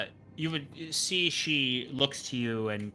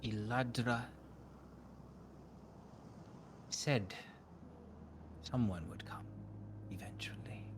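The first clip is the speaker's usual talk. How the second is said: lightly voiced mystic, slow and hushed in places; a cryptic oracle studying a stranger; a hint of an otherworldly prophetess; eerie seer woman; 12 dB quieter, slower than usual.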